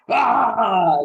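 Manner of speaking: loud berserker yell; battle cry. Acted. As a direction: bellow a raging war cry. Berserker turns on ally.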